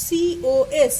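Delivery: কস then সিওএস spelled letter by letter, very slowly; teacher spelling কস slowly. Shown as a demonstration. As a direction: spell কস out for copying. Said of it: "সংক্ষেপে লিখছি কস theta".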